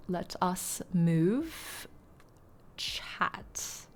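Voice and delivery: slow near whisper, "chat" fully whispered; whispered aside. Acted. Streamer whispering that she's moving chat, slow and quiet. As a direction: hush it, drawing out the words.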